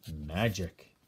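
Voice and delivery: low and soft, full of mystery; mysterious owl. Acted. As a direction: hold the secret in a low hush. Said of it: "she said".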